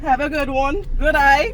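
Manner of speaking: calling out. Real, not acted. Loud goodbye calling out.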